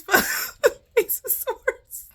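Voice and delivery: rising, high words that collapse into laughs; breaking into laughter. Real, not acted. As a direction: crack up mid-sentence, voice climbing. Cracks up at the card.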